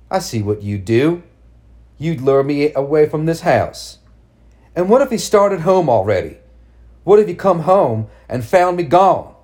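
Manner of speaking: building to loud, wild anger; rising fury. Acted. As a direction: let the madness rise. Mad mother rises in fury.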